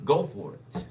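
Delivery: half-hearted yell of encouragement; mild mock yell. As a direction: yell it lightly, like an exclamation point. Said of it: "so it's yelling it".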